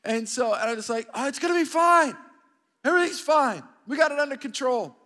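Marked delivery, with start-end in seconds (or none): 1.1-5.0 high, loud insistence that all is well; hammed-up panicky reassuring; frantic reassurance. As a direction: overdo the confidence, voice pushed up. Acting out his frantic reassurance to family.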